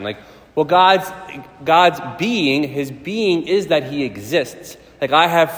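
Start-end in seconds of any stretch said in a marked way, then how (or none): none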